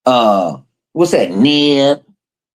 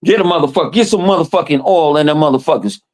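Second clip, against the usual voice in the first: usual speaking voice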